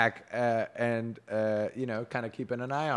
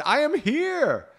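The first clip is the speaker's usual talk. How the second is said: high, loud, drawn-out call; crying out; 6 dB louder, much slower than usual. A narrator's reading. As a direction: call out theatrically to someone far off. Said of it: "Ewain cried out and said".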